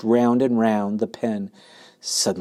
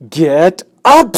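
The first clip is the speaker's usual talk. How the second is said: high, very loud scream; 8 semitones higher, 12 dB louder than usual. Acted. Pig screams at the lazy rat, very loud. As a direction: yell at him to move. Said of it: "screamed Wilbur".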